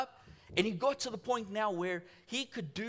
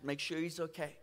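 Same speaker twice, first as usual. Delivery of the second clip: usual speaking voice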